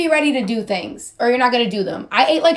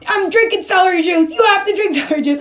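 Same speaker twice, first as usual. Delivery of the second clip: high, loud fanatical health-nut rant; mock scary; 8 semitones higher, 4 dB louder than usual. Mimicking own Facebook live, mock scary insistence. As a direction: imitate yourself ordering everyone onto juice, pitched way up. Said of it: "I was getting really scary".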